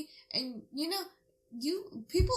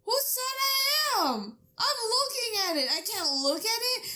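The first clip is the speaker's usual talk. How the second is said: indignant retort; 8 semitones higher, 4 dB louder than usual. Retold or imitated. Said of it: "And I'm just like".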